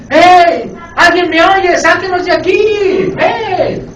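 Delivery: high, loud cries from a cell; mock prisoners yelling for rescue; shouting for help. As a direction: call out desperately to be let out.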